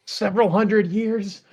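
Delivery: high, loud mock-impatient scribe; hammed-up overworked copyist; exasperated scribe. Acted scribe asking about printing press.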